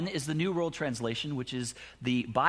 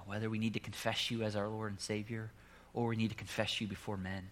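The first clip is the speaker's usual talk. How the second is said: soft, low closing prayer; hushed pastoral prayer; 3 semitones lower, 4 dB quieter than usual. Acted. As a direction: pray gently over the congregation.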